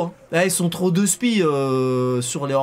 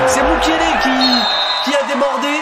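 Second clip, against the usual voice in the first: loud, high goal call; excited sports commentary; 7 semitones higher, 7 dB louder than usual. Acted. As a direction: shout the goal like a live commentator.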